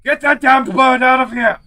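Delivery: panicked shout. Acted. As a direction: yell it in a panic.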